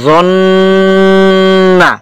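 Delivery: জন্না with a long, steady hum on ন; held ghunnah. Shown as a demonstration. Teacher demonstrating ghunnah to students, জন্না with prolonged ন. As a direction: hold the ন of জন্না very long, then release. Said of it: "এখানে কিছুক্ষণ সময় দূরে রাখতে হবে".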